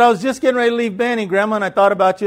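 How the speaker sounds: loud, high and rushed explanation; calling through a door. Retold or imitated. Grandchild explaining the visit to grandma outside, called out fast. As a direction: holler it quickly so she hears.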